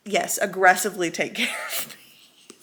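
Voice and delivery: giggling whisper through the words; whispered laugh. Real, not acted. Reader confessing she loves being cared for, giggling whisper. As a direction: laugh it out under your breath.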